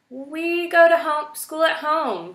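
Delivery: high, loud eager blurt; exclaiming. A narrator's reading. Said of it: "Poppy exclaimed".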